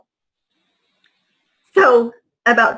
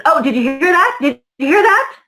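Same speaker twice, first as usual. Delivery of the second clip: high mock-innocent voice, pitched up; mocking guard; 4 semitones higher than usual. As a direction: fake alarm, needling her. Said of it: "And she goes".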